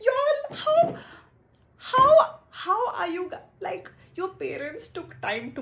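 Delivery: very high, loud cry of disbelief; high, loud despairing yell; exasperated wail. Real, not acted. Exasperated at a three-year heartbreak.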